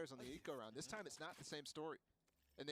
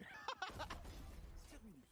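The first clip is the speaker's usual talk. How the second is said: laughing; 11 semitones higher than usual. Real, not acted. Laugh after the players trade kills.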